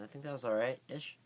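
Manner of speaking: normal talking voice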